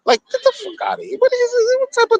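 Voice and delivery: very high-pitched, rushed disbelief; incredulous squeal. Real, not acted. Incredulous outburst at the man.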